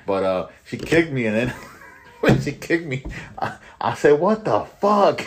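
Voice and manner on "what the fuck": high, drawn-out shocked cry; shocked what the fuck. Retold or imitated. Guard reliving the kick, shocked outburst. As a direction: blurt it out in disbelief. Shocked retort after being kicked.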